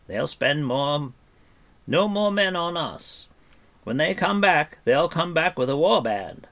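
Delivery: high, emphatic bard's voice; Fluter the bard, urgent. Acted. Bard warns companions a war band will return, urgent. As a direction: argue grandly for a retreat.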